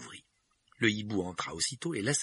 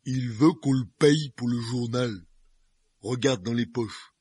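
giant voice; 5 semitones higher, 8 dB louder, slower than usual. A narrator's reading. Half-asleep giant explaining.

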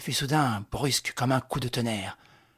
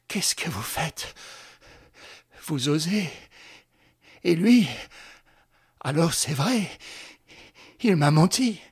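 stammering; 4 semitones higher, 3 dB louder than usual. A narrator's reading. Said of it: "Elle balbutia".

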